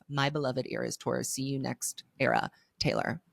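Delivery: measured, heartfelt read-aloud of a letter. Acted. Host reads the tour book's farewell letter aloud, reading aloud. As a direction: read it out evenly, phrases settling downward.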